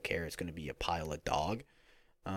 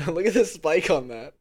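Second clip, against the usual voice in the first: loud, high, helpless laughing; 10 semitones higher, 12 dB louder than usual. Real, not acted. Podcaster laughing at their own audio spike, laughing. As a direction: crack up loudly while talking.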